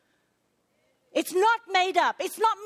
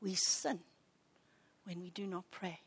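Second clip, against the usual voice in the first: much softer, low and hushed; hushed solemn; 10 semitones lower, 14 dB quieter than usual. Real, not acted. Preacher driving home the sin of not praying, hushed. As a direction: let it sink in quietly.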